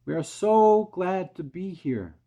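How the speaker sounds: high, loud and slow; calling out. A narrator's reading. James greets the crowd below, high, loud and slow. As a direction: call it down gladly. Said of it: "James called out".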